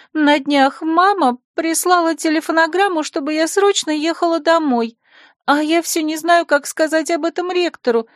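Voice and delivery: put-on high girlish chatter; spoiled girl. Secretary tells Anoria about her parents, high and girlish. Lulu's voice.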